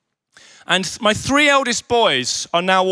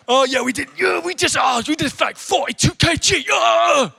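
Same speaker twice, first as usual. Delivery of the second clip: boastful sons; 4 semitones higher than usual. Retold or imitated. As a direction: show off the weight you lifted. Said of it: "What have you been doing".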